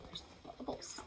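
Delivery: hushed aside; soft whispered note. Real, not acted. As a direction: breathe it softly. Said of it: "whispered remark on the page".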